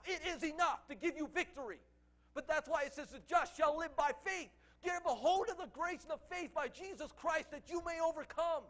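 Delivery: a preacher rallying the congregation against the devil, forcefully; fiery preaching. Acted. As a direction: preach it hard and build the crowd.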